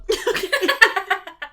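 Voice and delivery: squealing laughter, can't stop; high helpless laugh. Real, not acted. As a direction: laugh hard and high, out of control. Laughing fit after the raspberries.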